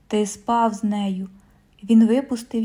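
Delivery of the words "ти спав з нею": ordinary delivery, nothing marked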